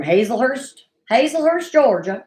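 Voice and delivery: a hint of a Georgia Southern accent. Shown as a demonstration. Host imitating Angela's Georgia Southern accent. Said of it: "I don't know if I'm doing the accent right".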